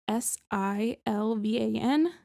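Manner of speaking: ordinary talk, nothing marked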